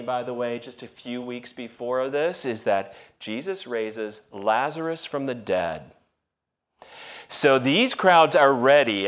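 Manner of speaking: ordinary talk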